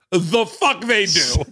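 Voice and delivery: slow, high-pitched incredulous retort; mock outraged disbelief. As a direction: drag it out in comic indignation. Dad's disbelieving retort to daughter.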